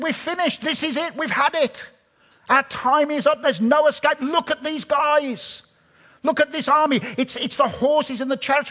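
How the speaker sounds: high, urgent, breathless despair; panicked servant. Acted. Elisha's servant seeing the army, high panic. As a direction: wail it like all hope is gone.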